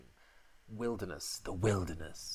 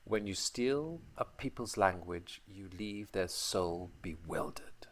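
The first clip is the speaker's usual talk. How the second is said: dramatic reading; 2 semitones lower, 3 dB quieter than usual. Shown as a demonstration. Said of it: "Go on, [name], you do it".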